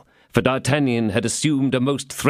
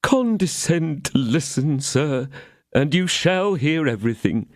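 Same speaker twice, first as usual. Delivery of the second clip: pleading innkeeper; 6 semitones higher than usual. A narrator's reading. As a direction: beg to be heard.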